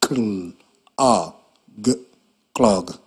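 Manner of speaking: segment the sounds of "clog" one by one; sounding out words. Shown as a demonstration.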